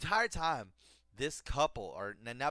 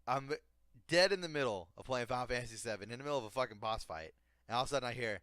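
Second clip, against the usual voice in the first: normal talking voice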